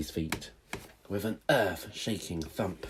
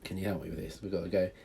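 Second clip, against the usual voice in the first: usual speaking voice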